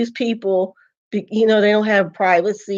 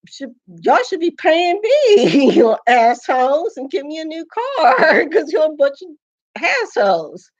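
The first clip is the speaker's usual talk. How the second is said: higher-pitched, exasperated outburst; indignant rant; 6 semitones higher than usual. Real, not acted. Woman telling off people who messed with the car, indignant. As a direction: demand it in a raised, indignant pitch.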